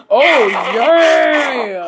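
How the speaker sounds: booming high "Oh, yeah!" cry; Kool-Aid man shout. Pretend. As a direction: burst through the wall yelling.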